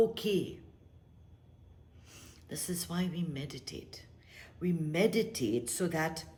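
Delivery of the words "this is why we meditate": whisper. Real, not acted. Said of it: "meditation teacher whispering".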